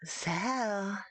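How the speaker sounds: dragonborn. Acted. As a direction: say the name slowly as a threat. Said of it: "this one tone".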